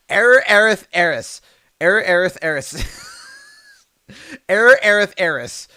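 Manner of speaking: loud, forceful tongue twister, laughing between takes; pushing harder at the twister. Shown as a demonstration. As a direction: belt out error Aerith Ares. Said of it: "try the other one".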